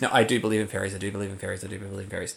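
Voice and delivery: fading to a murmur; quiet. Shown as a demonstration. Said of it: "You don't say that".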